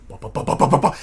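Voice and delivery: whispered pattering of feet down the hall; imitating footsteps sprinting down the hall; imitated running footsteps.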